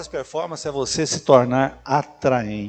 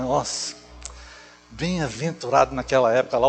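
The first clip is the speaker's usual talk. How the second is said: usual speaking voice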